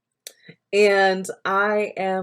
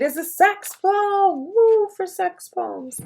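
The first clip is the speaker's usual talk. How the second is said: high, drawn-out whoop; playful cheer; 14 semitones higher, 5 dB louder, slower than usual. Real, not acted. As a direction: cheer it on, pitch soaring. Playfully cheers the coming poem.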